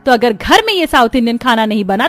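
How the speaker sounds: indignant mother-in-law outburst; erupting anger. Acted. Mother-in-law catching daughters-in-law with outside food, angry. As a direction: burst out in angry accusation. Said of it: "उसका गुस्सा एकदम ज्वालामुखी की तरह".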